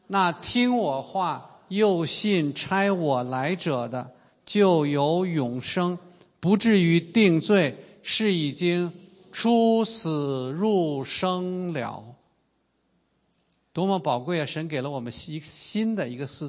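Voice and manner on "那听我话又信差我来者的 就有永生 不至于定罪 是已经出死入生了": slow scripture reading. Shown as a demonstration. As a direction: read it aloud slowly, word by word. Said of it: "请大家跟我一起来读吧". (缓慢领读经文)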